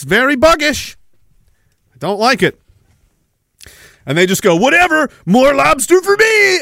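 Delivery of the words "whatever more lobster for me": mock them in a high, dragged-out voice; mocking lobster eater. Imitating lobster lovers who ignore the warning, mocking.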